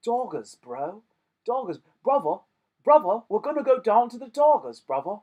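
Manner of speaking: hyped bro voice. Acted. Mock bro voice.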